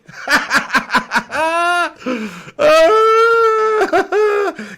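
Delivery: long laugh climbing to a high squeal; helpless laughing fit. Real, not acted. Streamer losing it at a viewer's pig comment, helpless laughing fit. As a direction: crack up completely, shrieking with laughter.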